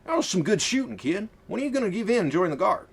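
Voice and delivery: cheerful folksy colonel. Acted. Marcus praising Laura.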